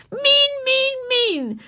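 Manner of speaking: very high tantrum chant, dropping at the end; angry little-girl chant; rhythmic yelling. A narrator's reading. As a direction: yell each word like a stamped beat. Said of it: "she yelled in time to her drumming heels".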